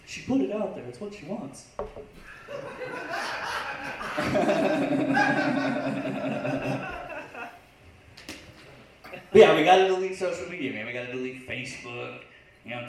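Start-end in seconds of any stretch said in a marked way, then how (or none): 1.7-7.6 long breathless laughing fit; real laugh. Real, not acted. Comic cracking up at his own joke, a long breathless laugh.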